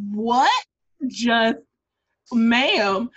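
loud, halting mock disbelief; stunned audience reaction. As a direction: blurt each word separately, as if stunned. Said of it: "we would be in the audience like".

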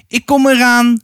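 a high call into the dark forest. A narrator's reading.

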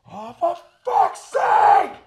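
high, drawn-out mock shriek; exasperated shout. Cafe worker seeing shattered glass, exasperated shriek. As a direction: yell it slowly at full exasperation. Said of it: "she just goes".

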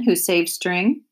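usual speaking voice

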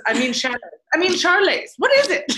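escalating self-correction on "chalets"; fumbling chalets. Retold or imitated. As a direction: imitate a flustered actor stumbling on "chalets". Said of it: "could not get the word chalets right".

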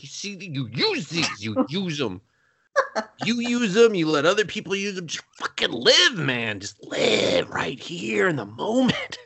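put-on half-hearted rousing old man, laughing at points; hammed-up urging to just live; mock grandpa pep talk.

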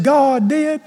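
high, mock-distraught wife; upset wife. Wife answering her husband on the couch, mock upset. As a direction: imitate a wailing woman, pitched high. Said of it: "She's upset".

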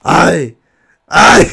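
loud mock cry; mock moan. The lady crying out in bed, loud mock moan. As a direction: belt out a hammed-up moan. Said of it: "Decía con voz gruesa".

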